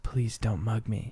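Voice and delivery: soft, slow, scared begging; begging a mugger not to rob, softly and slowly; meek pleading. Retold or imitated. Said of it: "But in reality, I was just like".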